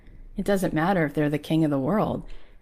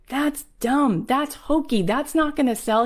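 higher-pitched, acted scorn; dismissive; 8 semitones higher than usual. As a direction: shoot the idea down in a retold quote. Director dismisses the movie idea.

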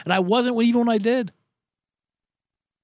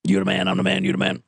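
much lower, swaggering radio-host voice; Scott Farrell voice; 12 semitones lower, faster than usual. Retold or imitated. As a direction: drop low and boast like a radio host. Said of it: "the guy who talked like this".

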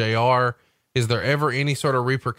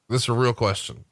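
ordinary talk

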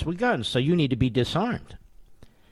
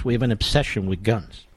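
mocking quote; 7 semitones lower than usual. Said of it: "these phrases that the Marxists use".

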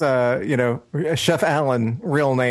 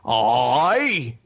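"I" drawn out, swooping up and down; stupid-sounding I. Retold or imitated. Co-host mocking He-Man's power line, overdramatic "I". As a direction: stretch "I" into an overblown, silly swoop. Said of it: "It just sounds stupid".

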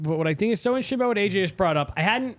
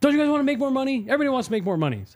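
quick, high, pumped-up sales pitch; rally the room, fast and high; movie quote impression; 9 semitones higher, much faster than usual. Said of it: "that scene in Wolf of Wall Street".

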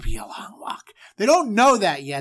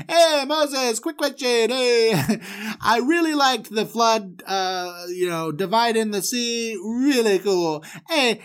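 whiny traveller; 5 semitones higher than usual. Acted. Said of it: "It's like".

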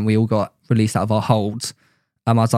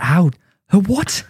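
higher-pitched, incredulous protest; retold indignation; 6 semitones higher than usual. Retelling an argument with the bouncer, mock irate. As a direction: act out mild outrage, pitch jumping up. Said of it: "I was irate".

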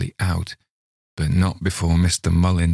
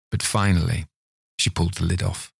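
ordinary delivery

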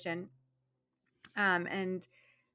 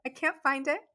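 high, louder playful bafflement; mock helplessness; 7 semitones higher, 5 dB louder than usual. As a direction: act out giving up the search, voice pitched up. Acts out searching in vain for success.

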